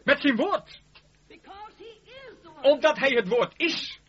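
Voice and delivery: fervent preacher's cry; preaching climax. Acted. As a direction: thunder it from the pulpit.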